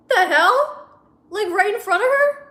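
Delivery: high, loud, incredulous questions; shocked disbelief. Real, not acted. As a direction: blurt it out appalled. Shocked reaction to the story.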